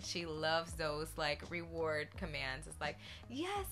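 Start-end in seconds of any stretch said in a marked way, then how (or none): 3.3-3.7 high, drawn-out cartoon praise; animated praise. Shown as a demonstration. Owner rewarding her Yorkie, high animated voice. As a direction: squeal praise slowly to a dog. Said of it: "be a cartoon character and be a little animated".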